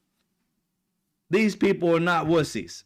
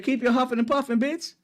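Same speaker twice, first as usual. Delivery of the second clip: much higher, cocky taunting voice; defiant Iran voice; 6 semitones higher than usual. Retold or imitated. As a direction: taunt America like you can't be touched. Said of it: "Iran said".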